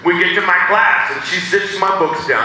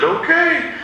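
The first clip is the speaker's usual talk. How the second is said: high nervous okay; 12 semitones higher than usual. Retold or imitated.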